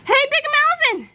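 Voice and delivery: high, loud call across the scene; shouted. A narrator's reading. George hailing Big Melvin on returning, shouted. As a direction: shout it out high, with each word carrying. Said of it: "shouted George".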